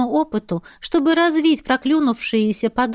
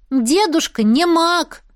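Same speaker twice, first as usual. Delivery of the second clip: put-on proud child; 6 semitones higher, 3 dB louder, slower than usual. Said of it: "гордо пояснил".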